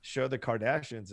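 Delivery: ordinary talk, nothing marked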